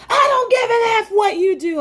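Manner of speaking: very high, loud mocking shout; mock yell. Coworker defying her report, high loud mock yell. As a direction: spit it out like a furious coworker. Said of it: "He was like".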